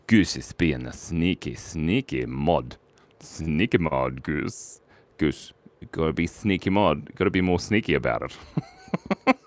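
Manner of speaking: low, soft, slow teasing; sneaky hush. Pretend. Teases mod about being sneaky.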